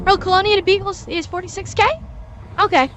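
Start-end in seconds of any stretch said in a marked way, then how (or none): none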